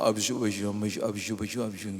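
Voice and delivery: put-on soft baby babble, fading away; toddler prayer babble. Father imitating his toddler's prayer, babbling softly. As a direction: babble like a tiny child praying. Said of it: "Doa dengan bahasanya dia".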